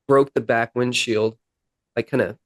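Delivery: usual speaking voice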